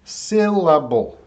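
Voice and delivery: "Syllable" slowly as sil-la-bull; syllable breakdown. Shown as a demonstration. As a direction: sound out "Syllable" in three beats. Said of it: "That's the first vowel sound".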